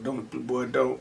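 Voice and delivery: a dead father's quiet caution; daddy's warning. Retold or imitated. Daddy looking down, low hushed warning.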